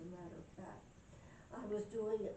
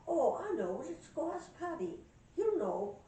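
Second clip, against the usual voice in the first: loud, lifted Irish exclaiming; Irish accent; 7 semitones higher, 8 dB louder than usual. Acted. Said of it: "I got me an Irish accent".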